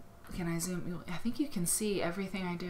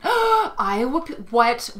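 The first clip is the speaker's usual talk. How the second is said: high, loud, drawn-out disbelief; astonished; 7 semitones higher, 12 dB louder, much slower than usual. Real, not acted. Host discovers an Iowa peace quilt live, astonished. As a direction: blurt it out in astonishment.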